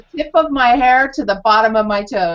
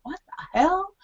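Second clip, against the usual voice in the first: mock bafflement; 9 semitones higher, 6 dB quieter than usual. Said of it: "people on the planet will go".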